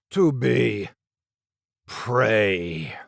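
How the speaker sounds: "prey" leaned on, falling and final; the monster punches "prey"; stress on prey. A narrator's reading. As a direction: hit "prey" hard, drawn out. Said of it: "laying special emphasis on the last word".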